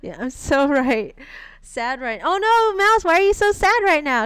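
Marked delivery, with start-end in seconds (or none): 2.2-4.2 high, loud, fast mock pity; mock concern. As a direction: fuss over them playfully. Teasing a chatter who is sad.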